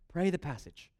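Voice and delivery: normal talking voice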